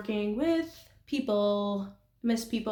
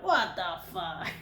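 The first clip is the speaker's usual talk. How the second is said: baffled movie guy; 2 semitones lower, much slower than usual. Retold or imitated. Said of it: "the one guy is like".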